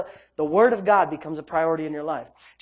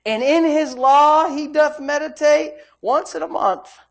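loud, raised preacher's delivery; forceful preaching; 8 semitones higher, 6 dB louder, slower than usual. Real, not acted. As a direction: drive it home to the congregation.

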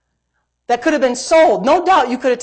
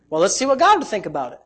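normal talking voice

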